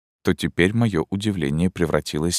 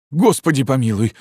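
a startled cry, a little higher; first surprised cry; startled exclamation; 7 semitones higher than usual. Acted. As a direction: blurt it out in shock. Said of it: "воскликнул я".

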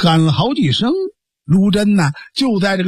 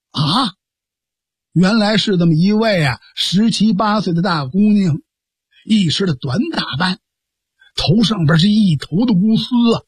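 ordinary delivery, nothing marked

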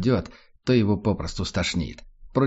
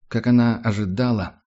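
ordinary delivery, nothing marked

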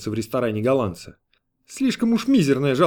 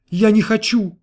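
high, loud, desperate refusal; petulant, upset outburst; near-tearful shout; 9 semitones higher, 5 dB louder than usual. A narrator's reading. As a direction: cry out like a customer close to sobbing. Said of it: "закричал он, едва не рыдая".

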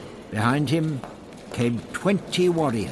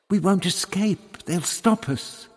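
much higher, louder, alarmed; anxious Barbara; 10 semitones higher, 4 dB louder than usual. Acted. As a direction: she fears they'll be caught, pitch rising. Barbara's fearful objection.

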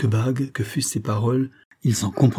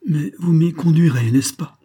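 higher, louder pleading, rising on n'est-ce pas; 7 semitones higher, 6 dB louder than usual. A narrator's reading. Girl begs Abraham to take her there, pleading. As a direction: beg him to keep his word. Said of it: "dit-elle d'un ton suppliant".

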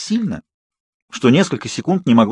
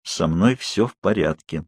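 lower, slow and softer, sullen; gloomy; 6 semitones lower, 4 dB quieter, slower than usual. A narrator's reading. Bella gloomily tells Charlie she is fine. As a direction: announce it darkly. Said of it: "мрачно объявила я".

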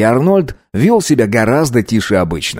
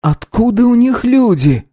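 character voice; 13 semitones higher than usual. A narrator's reading. Character voice asks.

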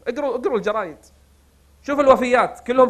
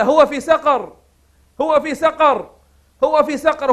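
angel's answer; 6 semitones higher, 4 dB louder, slower than usual. Retold or imitated. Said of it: "يجاوب عليه".

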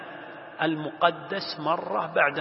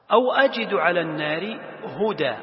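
louder, measured verse reading; Quran recitation; 2 semitones higher, 5 dB louder than usual. Acted. Teacher moving on to the next verse part, reciting. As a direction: recite with sustained vowels.

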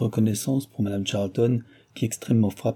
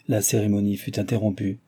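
plain reading voice

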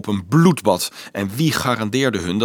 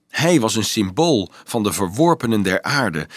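ordinary delivery, nothing marked

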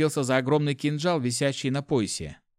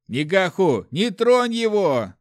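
high, loud, drawn-out cry; panicked guard yelling a warning; shouted warning; 7 semitones higher, 6 dB louder, slower than usual. A narrator's reading. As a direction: shout to stop a comrade urgently. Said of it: "крикнул второй страж".

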